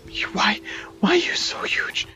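hushed unvoiced whisper at the bear; awed whisper at a huge bear. Real, not acted. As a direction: breathe it so the bear won't hear.

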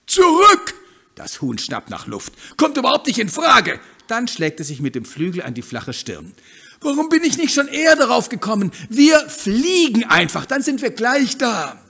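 high, loud, bubbly hen voice; lively bossy hen. Acted. Hen eager to leave the backyard, loud and high. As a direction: push ahead full of cheer.

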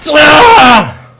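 high, loud scream of rage; angry scream. Real, not acted. Gamer angry at the match, frustrated scream. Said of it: "I'm angry now".

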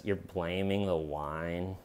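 low, slow, quiet disbelief; incredulous deadpan. Retold or imitated. Detective questions suspect's excuse, quietly incredulous. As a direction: question it softly and slowly, imitating an unimpressed detective. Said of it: "in that tone of voice".

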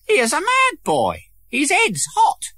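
put-on high jeering boy's voice calling out; rally the others against him; mocking schoolboy. A narrator's reading. Schoolboy calling to friends, high and jeering. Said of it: "The boy called out to the others".

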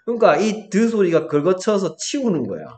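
normal talking voice

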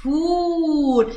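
فول with the long u held very slowly; teacher draws out the و in فول; stretched demo. Shown as a demonstration. Teacher demonstrates madd with waw, stretched vowel. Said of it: "نطقتها إيه".

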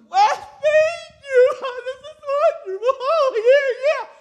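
squealing high and loud, laughing through it; delighted girl. Retold or imitated. Pastor imitating a complimented girl, high delighted squeal. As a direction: gush like a flattered girl overcome with joy. Girl thanked for being called beautiful.

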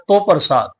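"ਤੋ" said as "to", rounded vowel instead of the vav; aunkar reading. Shown as a demonstration. Teacher giving the scholar's alternative reading, rounded "o". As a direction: say "ਤੋ ਪ੍ਰਸਾਦ" with a rounded "o". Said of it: "ਐੜਾ ਮੁਕਤਾ ਰੱਖ ਕੇ ਉਚਾਰਨ ਕਰਨਾ ਹੈ".

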